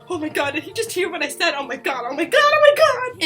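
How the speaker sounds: building to a high, loud squeal; panicked fangirl. Retold or imitated. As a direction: spiral into giddy panic. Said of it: "I was just like".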